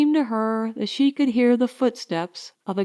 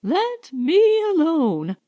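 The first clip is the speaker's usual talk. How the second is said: weary plea; 7 semitones higher, slower than usual. Acted. Pleading to be left alone.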